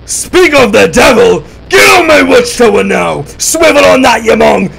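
high, loud, slowed yelling; shouting up the tower in panic; panicked shout. Real, not acted. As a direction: bellow at the intruder in alarm.

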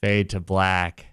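deadpan. Pretend. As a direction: say it dead flat, no smile. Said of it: "I'm gonna do a deadpan voice".

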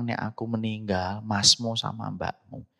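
ordinary talk, nothing marked

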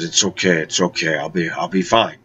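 soft, gentle polite brush-off; quietly and politely decline. Retold or imitated. Sick guest softly declining the healer's treatment, gentle and polite. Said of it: "I very politely said".

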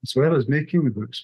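ordinary talk

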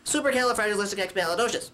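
fast, run-together long word; rattled off. Shown as a demonstration. Puppet shows viewers a long word, rattled off. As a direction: race through the whole word in one go. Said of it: "Or you could just say".

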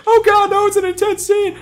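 high mock-dramatic wail; mock distraught. Friend mocking the movie's intense scene, mock distraught wail. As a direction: ham up the despair. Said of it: "It's like".

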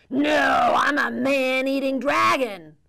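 loud booming dragon shout; dragon roar. Acted. Man-eating dragon shouting at the cowboy. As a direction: roar it to scare him off.

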